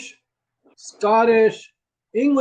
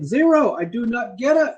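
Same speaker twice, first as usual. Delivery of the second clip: ordinary talk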